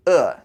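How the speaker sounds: "Uh" with a clenched mouth, like disgust. Shown as a demonstration. Teacher modelling the Chinese E, disgusted clenched "Uh". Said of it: "add a sound of disgust".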